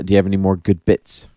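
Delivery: normal talking voice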